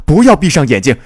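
loud, high-pitched urgent cry; urgent shout. A narrator's reading. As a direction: shout to rouse someone fading away. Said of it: "又一次地喊道". (急切地大声喊叫)

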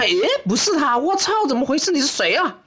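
high-pitched, startled disbelief; acted disappointment. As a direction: act out a blind-date shock, voice jumping up. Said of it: "就那种失望和无奈的脸啊". (夸张的错愕失望)

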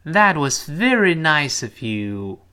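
slow, careful model sentence; slow model read. Shown as a demonstration. Teacher reading slowly for the class to repeat. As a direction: read it slowly for pupils to copy. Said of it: "再来一遍".

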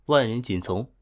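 ordinary delivery, nothing marked

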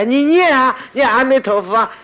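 very high, loud mimicked plea; hammed-up shout to start chanting; mock urging. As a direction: push the voice way up, loudly imitating an urgent demand. Acts out urging someone to chant.

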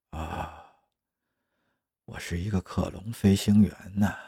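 soft, dazed self-talk; dawning realization. Acted. Clone pilot staring at the ceiling, realizing what he is, softly dazed. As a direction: say it quietly, as it sinks in. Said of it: "终于意识到了这一点".

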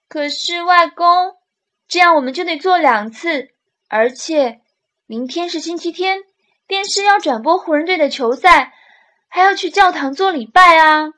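high, loud whining plea; begging to get out of it; pleading. Acted. Boy pleading with grandfather.

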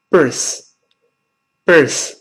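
birth with a breathy th, tongue between the teeth. Shown as a demonstration. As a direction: show the th in birth. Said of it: "舌尖吐出来一点".

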